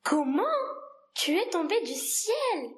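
astonished exclamation. Acted. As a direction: cry out in surprise, pitch lifting on the question. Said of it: "Alors il s'écria". (exclamation étonnée)

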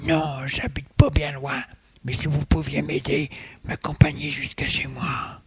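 put-on breathy whispered old man; short of breath, barely voiced; breathless old person. A narrator's reading. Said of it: "Le vieil homme est tout essoufflé".

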